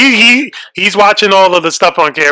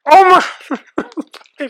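squeamish; 12 semitones higher, 4 dB louder, much slower than usual. Real, not acted. Squeamish at bugs.

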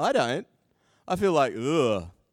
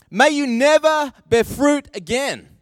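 loud, higher pronouncement; commanding curse; 8 semitones higher, 7 dB louder than usual. Pretend. Jesus cursing the fig tree, loud and commanding. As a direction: declare it over the tree. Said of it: "So he said to the fig tree".

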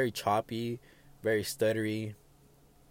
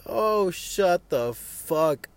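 loud, high, fed-up yelling at complainers; shouting down whiny people online; angry rant; 7 semitones higher, 7 dB louder than usual. Real, not acted. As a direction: let it rip, fed up and shouting.

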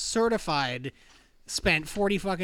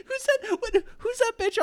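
laughing; 4 dB louder than usual. Real, not acted. Laughing mid-question.

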